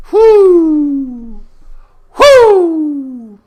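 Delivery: long high whoop, falling at the end; loud whoop. Real, not acted. Vlogger celebrating his own joke, loud high whooping. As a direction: let out two big drawn-out whoops.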